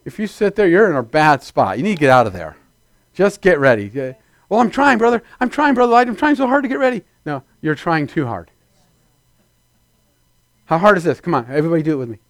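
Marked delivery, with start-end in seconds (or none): 4.5-7.0 higher pleading voice of a straining believer; whiny believer. Retold or imitated. A believer pleads with Brother Light, high and whiny. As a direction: beg Brother Light, whining that you're trying. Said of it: "whiny believer quoted back".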